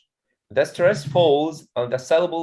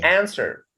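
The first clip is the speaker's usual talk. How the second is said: "answer" as AN-swer, falling after AN; "answer" with the weight up front; stress on first syllable; 5 semitones higher than usual. Shown as a demonstration. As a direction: model AN-swer for the class. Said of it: "at the beginning".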